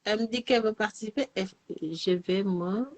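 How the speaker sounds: distant, far from the mic; talking away from the phone; phone held away. Real, not acted. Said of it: "trop en colère".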